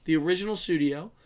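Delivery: ordinary delivery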